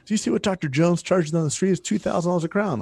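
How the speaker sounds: high-pitched mock outrage at a rival's fees; lightly mocking, high complaining voice; indignant complaint. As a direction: imitate a jealous, indignant colleague. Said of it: "They get mad at what other people are charging".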